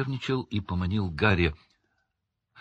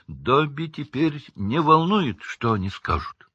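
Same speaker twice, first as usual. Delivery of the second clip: defiant; 6 semitones higher, 4 dB louder than usual. A narrator's reading. Dobby shrugging off old masters' opinion, defiant. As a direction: declare it with raised pitch, deliberately. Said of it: "с вызовом произнёс Добби".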